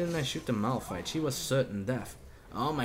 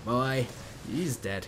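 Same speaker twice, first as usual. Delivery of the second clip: normal talking voice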